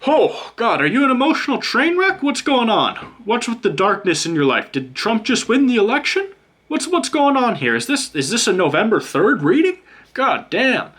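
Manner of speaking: high, hammed-up alarm at the card; mock shock. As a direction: gasp at the bad news like it's a disaster.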